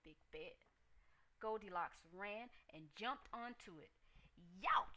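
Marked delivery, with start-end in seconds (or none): 4.3-5.0 loud pained yelp; cried youch. A narrator's reading. Goldilocks jumps on the hard bed, loud yelp. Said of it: "she cried".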